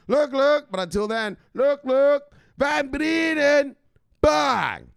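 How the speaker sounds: high, loud, shouting hype voice; hype-man rant. Acted. Hyped vlogger shouting crypto news at his mama.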